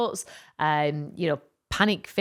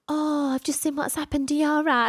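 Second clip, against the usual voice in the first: worried neighbour; 8 semitones higher than usual. Retold or imitated. Concerned stranger quoted.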